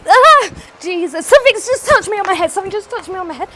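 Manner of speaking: real yelp into high, loud, rushed panic; terrified. Real, not acted. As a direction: cry out as if suddenly touched in the dark. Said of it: "this was absolutely terrifying".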